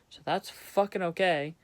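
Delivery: usual speaking voice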